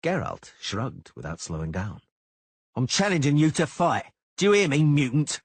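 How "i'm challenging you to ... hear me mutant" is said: much higher and louder shouted challenge; enraged shouted challenge. A narrator's reading. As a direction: shout him down in the street. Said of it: "Cicada shouted".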